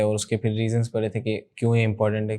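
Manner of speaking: ordinary talk, nothing marked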